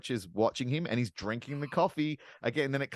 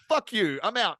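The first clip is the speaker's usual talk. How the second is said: higher-pitched imitation of an angry brush-off; mock defiant outburst; 10 semitones higher, 4 dB louder than usual. Retelling the film character's exit, mock defiant.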